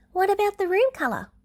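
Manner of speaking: put-on high child voice, eager; a young girl checking; little girl Eri. Eri asks June in a high child voice.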